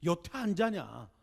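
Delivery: mother at the door telling her son to sleep, slow loud nag; mother scolding. Retold or imitated. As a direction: drag it out like a mother nagging her son to bed. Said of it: "꼭 한마디 하세요".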